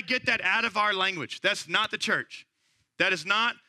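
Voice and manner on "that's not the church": ordinary talk, nothing marked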